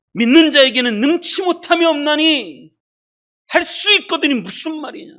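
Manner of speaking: high, forceful scripture quote; preacher crying out a challenge; loud proclamation. Acted. Preacher proclaims Jesus' rebuking words.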